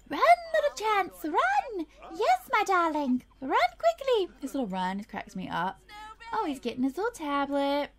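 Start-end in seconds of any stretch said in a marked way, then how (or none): 0.1-4.3 high, put-on urging voice; theatrical high-pitched encouragement; cooing cheerleader. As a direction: cheer a toddler along, high and playful. Cheering on running toddler in game.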